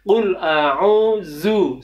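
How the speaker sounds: "Qul a'udzu" with a z sound; wrong pronunciation. Shown as a demonstration. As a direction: say "a'udzu" with a plain z.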